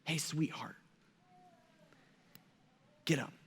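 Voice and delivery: soft tender "Hey, sweetheart, get up"; Jesus gently waking the girl, soft and tender; endearing call. Retold or imitated. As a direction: gently wake a little girl. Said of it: "is more endearing".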